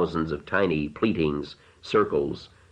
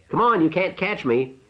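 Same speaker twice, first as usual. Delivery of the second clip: high playful taunt; teasing shout; 12 semitones higher than usual. A narrator's reading. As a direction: taunt him from afar. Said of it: "she shouted".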